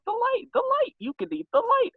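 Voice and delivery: very high-pitched urging; mock insistence. Hostess urging guests to eat the light, mock insistence. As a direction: press the point in a squeaky comic voice.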